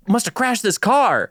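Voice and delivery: high amazed disbelief; retold wide-eyed amazement. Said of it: "I was like".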